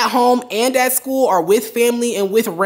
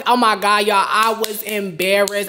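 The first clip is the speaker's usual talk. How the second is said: normal talking voice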